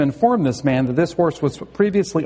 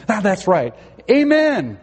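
high called-out command to a horse; stop command; 4 semitones higher than usual. Acted. Rider remembering the word to stop, called out high.

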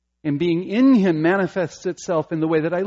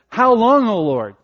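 loud, high pleading lament; psalmist's cry; 7 semitones higher, 7 dB louder than usual. Retold or imitated. Preacher voicing the psalmist's anguished plea, loud and high. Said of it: "as the psalmist says".

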